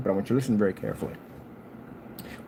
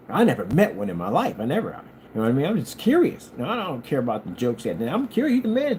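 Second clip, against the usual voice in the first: normal talking voice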